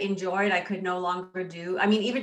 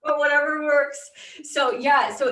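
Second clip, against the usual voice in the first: laughing; 6 semitones higher, 4 dB louder than usual. Real, not acted. Laughing after toilet paper joke.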